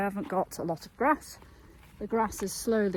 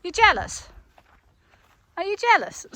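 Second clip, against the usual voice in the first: much higher, louder teasing; teasing pet voice; 10 semitones higher, 6 dB louder than usual. Real, not acted. Owner teasing her jealous horse in a high pet voice. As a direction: tease the horse in a high sing-song.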